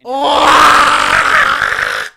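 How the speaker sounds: a loud, high yelp that breaks into laughter; real shock bursting into laughs; sudden shout and laugh. Real, not acted. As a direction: caught off guard, a loud cry and a cackle. Bursts out at Irish claim.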